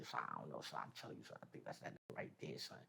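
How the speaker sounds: hushed secret to a buddy, barely breathed; whisper. Retold or imitated. Secretly telling friend that's his father.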